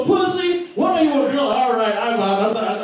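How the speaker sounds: usual speaking voice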